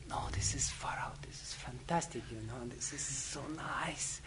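hushed, near-whispered inner thought; hushed delight. Acted. Lady's secret thought, lying in bed.

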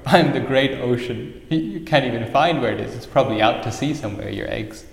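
loud scornful taunts broken by guffaws; mocking laughing ocean. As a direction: play a mighty ocean laughing at a tiny challenger. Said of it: "The ocean started laughing and laughing".

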